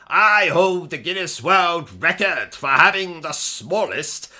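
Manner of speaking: usual speaking voice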